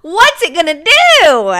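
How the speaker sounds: loud laughing suspense. Retold or imitated. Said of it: "So I was like".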